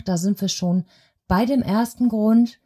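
ordinary talk

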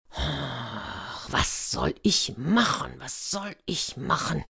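soft, slow, sighing lament; despairing sigh. Acted. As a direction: sigh heavily and agonize over the decision. Ruler in a quandary, gives in.

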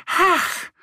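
high, delighted little duck squeal; gleeful splash-up shout; joyful cry. A narrator's reading. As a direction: burst out of the water with joy. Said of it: "ruft sie voll Freude".

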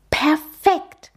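put-on high delighted child; excited. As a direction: cheer it in delight. Cub delighted.

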